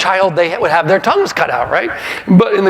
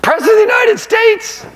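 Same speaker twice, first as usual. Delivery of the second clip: incredulous exclamation. Real, not acted. Comedian marvelling at a cheating president, incredulous high cry. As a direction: throw it out in sheer disbelief.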